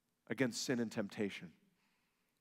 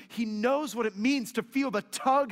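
loud, high rising climax; full-force preaching; 9 semitones higher, 7 dB louder than usual. Real, not acted. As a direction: preach it up to the top.

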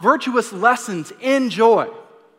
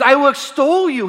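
higher and louder, bursting with praise; excited exclaiming; 6 semitones higher, 6 dB louder than usual. Retold or imitated. Pastor voicing David excited about his healing, exclaiming. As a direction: lift it up like a joyful shout. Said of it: "David is excited".

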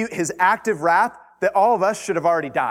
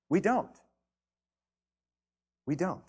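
quiet; 7 dB quieter than usual. Acted. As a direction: drop to a hush after the climax. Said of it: "repeated answer, much quieter".

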